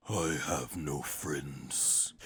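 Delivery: very low, slow, soft and cold; Jiren's cold line. Retold or imitated. Jiren rejecting Toppo, low cold voice. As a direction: say it flat and heavy. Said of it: "And Jiren's like".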